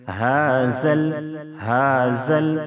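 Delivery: ہازل with the ذال hissed like a ز; faulty hissing dhal; wrong z sound. Shown as a demonstration. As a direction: show the mistake, ہازل with a sharp whistling ز. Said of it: "ہازل نہیں ہونا چاہیے".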